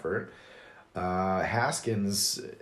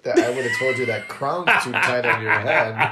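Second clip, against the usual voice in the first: laughing; 4 semitones higher, 9 dB louder than usual. Real, not acted. Mock-boasting teasing comeback.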